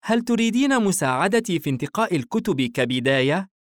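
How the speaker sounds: put-on high-pitched, keen boy's offer; eager son. A narrator's reading. Son offering his mother help choosing books, eager. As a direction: ask keenly as the son, pitched right up. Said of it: "بورسن بشغف".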